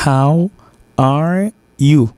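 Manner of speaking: HOW, pause, ARE, YOU, each word held apart; slow teacher's word-by-word "How are you". Shown as a demonstration. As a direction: break "How are you" into three distinct words. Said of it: "Ça fait trois mots".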